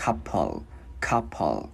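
"couple" in two low, measured syllables ending on L; flicked L ending. Shown as a demonstration. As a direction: kick the tongue up for the L in "couple". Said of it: "đá cái chữ L lên". ("couple", đá lưỡi lên âm L cuối)

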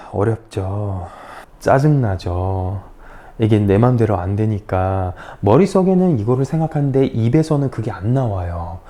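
usual speaking voice

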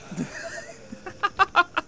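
high, wheezy burst of laughter; real laughing. Real, not acted. Gamer watching the town burn, cracking up.

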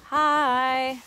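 calling out a bright hi; loud hello called out; loud greeting. Real, not acted. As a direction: shout hi across the yard. Loud greeting to arriving person.

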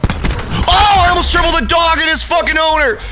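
high-pitched yell of excitement; excited yelling. Real, not acted. Gamer yelling over a near triple kill, excited. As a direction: shout it out as the play happens. Excited outburst during play.

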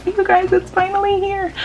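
very high, loud excited squeal; thrilled squeal. Real, not acted. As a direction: squeal with joy over the new camera. New camera finally arrived.

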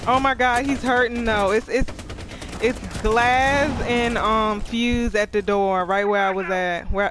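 loud, slowed information call; loud callout. Real, not acted. Streamer loudly tells teammates where the enemy killed her, loud callout. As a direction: call it out clearly to the team.

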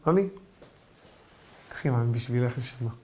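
tender husband. Acted. Husband voiced serving wife tea.

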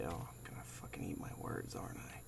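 scared hushed whisper. Real, not acted. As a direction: whisper it in dread. Scared whisper in horror game.

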